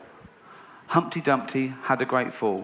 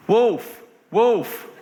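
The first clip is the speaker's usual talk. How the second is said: raised cry, falling away; mock alarm call; 7 semitones higher, 4 dB louder than usual. Bored boy tricking the villagers, mock alarm call.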